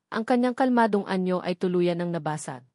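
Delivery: ordinary delivery, nothing marked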